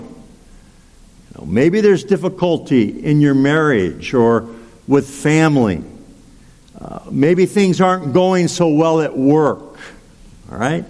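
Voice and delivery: ordinary delivery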